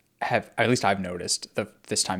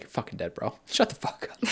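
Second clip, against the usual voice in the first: whispered, softer death threat; menacing whisper; 5 dB quieter than usual. Retold or imitated. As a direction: hiss the threat like a killer. Voicing Arya killing the leader.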